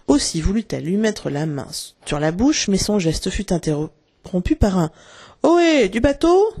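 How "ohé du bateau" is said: high, drawn-out call rising at the end; ringing hail. A narrator's reading. Crochet hailing his men across the lagoon, ringing call. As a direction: hail a boat far across the water. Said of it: "qui résonna sur la lagune".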